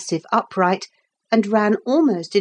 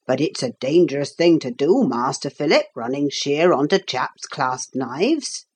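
put-on genial old carpenter; 4 semitones lower than usual. Carpenter to boy who ran at his knife, genial caution. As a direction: lower workman's voice, mildly cautioning.

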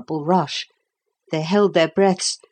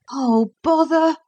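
put-on dismayed boy; 11 semitones higher than usual. A narrator's reading. Philip dismayed.

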